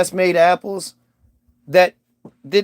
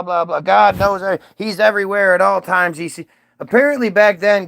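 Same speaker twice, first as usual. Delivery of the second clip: usual speaking voice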